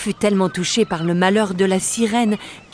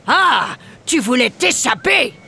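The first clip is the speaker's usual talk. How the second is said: high, far pirate captain voice; captain's shout; 5 semitones higher than usual. Acted. Captain yelling at the mermaid, pirate bellow. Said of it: "hurla le capitaine Horribe".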